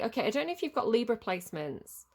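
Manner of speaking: ordinary talk, nothing marked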